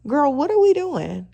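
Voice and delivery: ordinary talk, nothing marked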